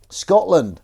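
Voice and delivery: shouted, high "Scotland!"; mock cheer. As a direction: belt out the country's name. Mock cheer at Scottish claim.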